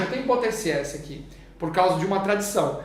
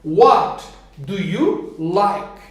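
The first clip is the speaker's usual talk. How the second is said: slow modeling; 4 dB louder, much slower than usual. Shown as a demonstration. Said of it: "teacher models the question again".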